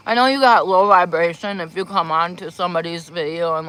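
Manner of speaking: ordinary delivery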